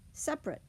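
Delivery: "separate" in two syllables, SEP-rit; syncopated schwa. Shown as a demonstration. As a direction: drop the middle vowel of "separate". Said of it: "It's a deletion of syllables".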